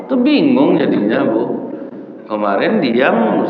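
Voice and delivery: usual speaking voice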